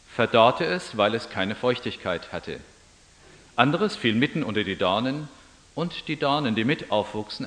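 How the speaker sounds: measured, solemn Bible reading; scripture reading. Acted. Pastor reading the Gospel passage, solemn and measured. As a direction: read the Gospel aloud to the congregation.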